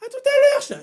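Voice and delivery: high-pitched, drawn-out farewell; mock spooky send-off. Scare actor slipping back into role, high mock send-off. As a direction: call it out high like a haunted-house ghoul.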